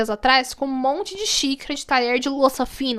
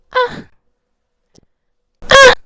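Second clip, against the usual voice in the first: high "ah", then a much louder one; imitated background noise; 10 semitones higher, 11 dB louder than usual. Retelling noises from a couple behind in the cinema, mimicked high cries. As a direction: mimic overheard cries, the second suddenly loud. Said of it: "eu só ouvi um barulhinho assim".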